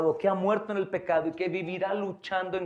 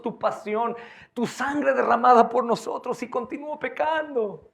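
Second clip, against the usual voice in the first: impassioned prayer; 5 semitones higher, 4 dB louder than usual. Acted.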